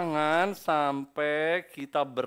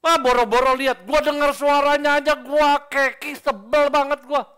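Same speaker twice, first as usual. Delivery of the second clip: loud, higher, fed-up complaining; irritated rant; 11 semitones higher, 15 dB louder than usual. Retold or imitated. Preacher voicing someone annoyed just hearing another's voice, loud irritated rant. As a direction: rant like the very sound of them grates.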